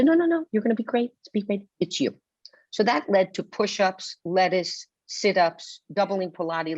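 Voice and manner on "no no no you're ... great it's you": 1 a touch of cheery producer. Retold or imitated. Producers reassuring her.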